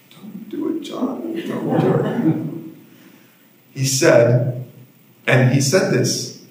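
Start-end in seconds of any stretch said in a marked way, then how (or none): 0.1-2.5 softer, breathy pleading hiss; urgent whisper. Retold or imitated. Boys urging their friend not to stand up, whispering. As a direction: beg your friend under your breath. Kids begging John not to confess.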